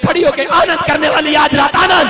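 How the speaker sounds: loud, high preacher's shout; preacher rousing the women to stand and rejoice; preaching at full force. Real, not acted. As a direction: rouse them to stand and rejoice. Preaching climax.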